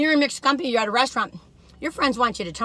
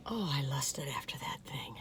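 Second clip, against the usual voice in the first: low, hushed whisper; longing whisper; 9 semitones lower, 16 dB quieter than usual. Real, not acted. Reviewer recalling a coveted Nutella jar, longing whisper.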